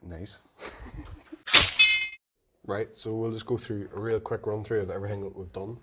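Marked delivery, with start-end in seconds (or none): 0.6-2.1 high laugh. Real, not acted. Giggling after the wrap-up.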